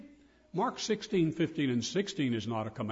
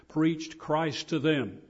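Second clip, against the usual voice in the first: "preached Christ to them" slow and weighty, louder; emphatic; 4 dB louder, much slower than usual. Real, not acted. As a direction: stretch out each word of "preached Christ to them".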